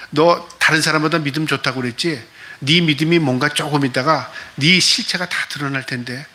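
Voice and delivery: normal talking voice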